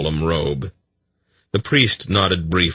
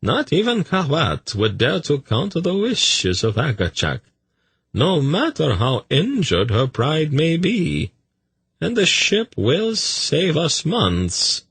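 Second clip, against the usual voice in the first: smooth courtier Tzadi; 11 semitones higher than usual. Acted. Tzadi remarking to the priest on a priestess, high and measured. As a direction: lift the pitch high and stretch the words.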